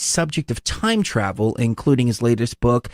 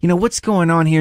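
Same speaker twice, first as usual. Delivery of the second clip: puzzled; 6 semitones higher than usual. Retold or imitated. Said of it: "you're like".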